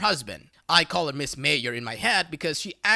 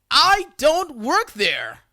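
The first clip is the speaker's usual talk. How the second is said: very slow, higher, louder, each word spaced out; retold fed-up shout; exasperated yell; 8 semitones higher, 7 dB louder, much slower than usual. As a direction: spell it out in disbelief. Narrator retells yelling back.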